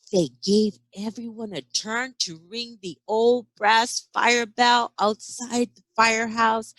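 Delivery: plain reading voice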